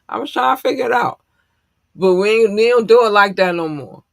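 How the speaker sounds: high, loud self-defence; playful protest. Real, not acted. Streamer defending old show notes to listeners, loud high protest. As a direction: raise the voice in a pleading protest.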